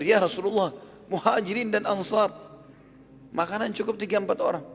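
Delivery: high-pitched startled objection; acted dismay, voice pushed up high; shocked protest. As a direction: protest in alarm at the huge crowd. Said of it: "Kaget di depannya Rasulullah".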